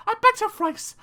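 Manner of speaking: usual speaking voice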